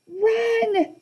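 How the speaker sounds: high goat-like bleat. A narrator's reading. Grover urges them to flee, bleating high. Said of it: "Grover bleated".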